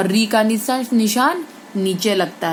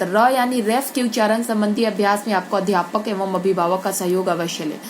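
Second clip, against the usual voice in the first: ordinary talk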